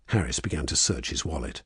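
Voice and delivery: usual speaking voice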